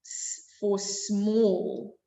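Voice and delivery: "S for small" dragged out slowly. Shown as a demonstration. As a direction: spell out "S for small" word by word. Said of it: "repeated teaching phrase".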